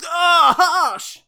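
sudden laughing yell; shout. Acted.

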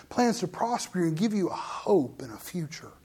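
soft unvoiced whisper of God's promise; God voiced in a hushed whisper. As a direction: breathe the promise gently. Voicing God's gentle promise.

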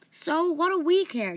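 high, slow defensive retort. A narrator's reading. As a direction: push back, drawing the words out. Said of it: "Jahiri said defensively".

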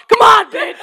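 high, loud hammed-up challenge; mock taunt. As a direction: taunt like a brawler. Mimicking a fighter taunting.

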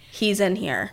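flat, quiet monotone. Retold or imitated. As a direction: say it flat like a haunting figure. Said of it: "with a monotone voice".

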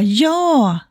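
acted high, drawn-out yes; eager agreeing. Codependent walker accepting without checking her needs, eagerly agreeing. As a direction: jump at the invitation with a high yes.

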